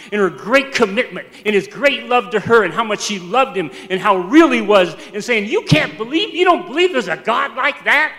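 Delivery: loud, driving retelling of her testimony; fervent testimony. Retold or imitated. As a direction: pile it up phrase on phrase, loud and insistent. Preacher relives Frankie's fiery testimony.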